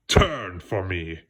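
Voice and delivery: low slow monster command; mild slow villain order; shouting dark frog. Acted. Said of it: "he shouted".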